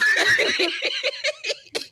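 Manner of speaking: very high-pitched giggling fit; high laughter. Real, not acted. Friends hearing the bulletproof joke, high laughing fit. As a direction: crack up at the joke.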